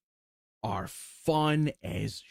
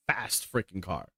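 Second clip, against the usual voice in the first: normal talking voice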